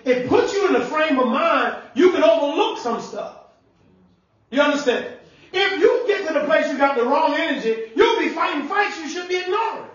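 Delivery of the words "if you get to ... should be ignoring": building preaching. Acted. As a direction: climb to a preached shout with a laugh.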